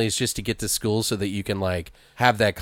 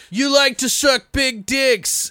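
very high, slow, timid nerd voice; flustered nerd; 3 dB louder, slower than usual. Retold or imitated. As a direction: nerd misreads the order in a panic. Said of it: "and he's like".

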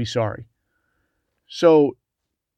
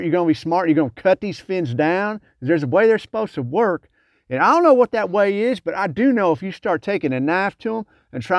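higher-pitched, fired-up buddy rant; escalating comedy rant; 5 semitones higher than usual. Retold or imitated. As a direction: rant it like the funny guy at the brief. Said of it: "goes on a rant about".

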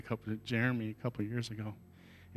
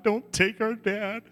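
higher and slow, a pleading cry; retelling the children's desperate plea; kids crying out; 10 semitones higher, 6 dB louder, slower than usual. Retold or imitated. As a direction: beg God for their father. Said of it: "crying out to God".

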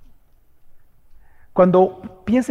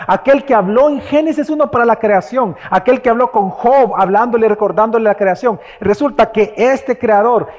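rising, louder preaching build; 3 semitones higher, 5 dB louder than usual. Acted. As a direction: preach it out, building each line. (predicación creciente y enfática)

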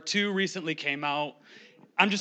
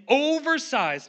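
"oversized" said slowly, pitched higher; stretched emphasis; 7 semitones higher, slower than usual. Acted. Preacher hyping Thanksgiving plates, slow lifted "oversized".

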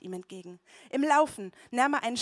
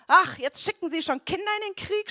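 loud, high mocking jeer; mocking giant; 9 semitones higher, 9 dB louder than usual. A narrator's reading. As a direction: sneer down at a child. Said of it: "spottete er".

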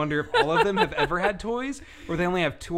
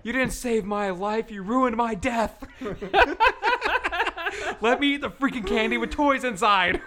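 mock aggrieved wail; 7 semitones higher than usual. Protesting being denied candy with toys inside, hammed-up high wail. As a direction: play up the outrage in a high voice.

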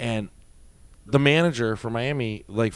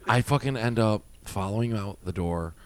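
ordinary talk, nothing marked

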